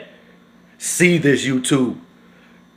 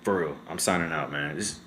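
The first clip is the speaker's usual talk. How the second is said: much softer, lower, drained; emotional sign-off; 8 semitones lower, 11 dB quieter than usual. Real, not acted. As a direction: sign off still shaken and quiet. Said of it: "I'm getting too emotional".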